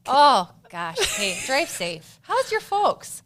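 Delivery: hammed-up Minnesotan accent; mock Minnesotan accent. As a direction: play the friendly Minnesotan neighbor. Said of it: "drop a Minnesotan accent".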